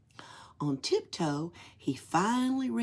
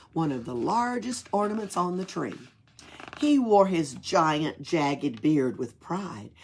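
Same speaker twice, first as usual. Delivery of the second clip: ordinary delivery, nothing marked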